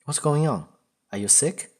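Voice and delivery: acted alarm, checking on someone; concerned. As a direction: ask with sudden concern. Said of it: "He sounds concerned".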